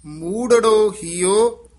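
ਮੂੜੜੋ slow and loud with both ੜ clearly doubled; both ੜ sounded. Shown as a demonstration. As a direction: land hard on the double ੜ in ਮੂੜੜੋ. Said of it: "ਦੋਵੇਂ ਉਚਾਰਨ ਕਰਨੇ ਹਨ".

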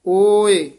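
loud, stretched ਓਏ standing alone; brief break after ਓਏ. Shown as a demonstration. Teacher marking the short break, loud long ਓਏ then pause. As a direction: hold ਓਏ loudly, then pause. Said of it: "ਇੱਥੇ ਯਮਕ ਹੈ".

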